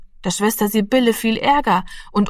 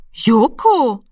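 drawn-out, higher-pitched surprised name; astonished; 4 semitones higher, 4 dB louder than usual. A narrator's reading. Woman sees the cat home early, astonished. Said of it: "sagte sie erstaunt".

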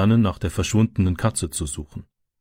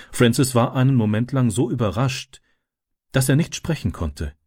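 usual speaking voice

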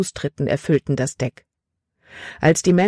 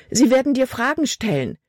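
high, urgent outburst; words bubbling out; 8 semitones higher than usual. A narrator's reading. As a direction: blurt it out in alarm. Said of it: "Die Worte sprudelten aus Libby heraus". (aufgeregt heraussprudelnd)

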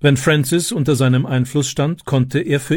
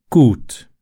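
ordinary delivery

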